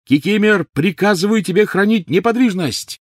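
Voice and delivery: high, loud urgent command; shouted order. A narrator's reading. Master stopping the elf hurting himself, shouting. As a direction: shout over the screaming. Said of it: "Гарри перекричал обоих".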